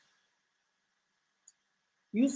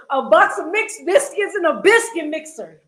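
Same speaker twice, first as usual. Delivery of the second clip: laughing; 8 semitones higher, 8 dB louder than usual. Shown as a demonstration. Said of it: "I gotta get it right".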